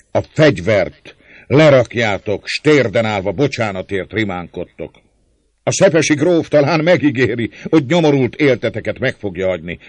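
loud, high-pitched angry denunciation; furious tirade. A narrator's reading. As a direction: build from measured accusation to shouted dismissal. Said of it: "Az örökös ingerültség".

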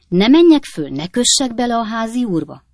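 loud, high opening question; volunteer boldly to pick a fight; eager offer. A narrator's reading.

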